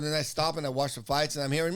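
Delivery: ordinary talk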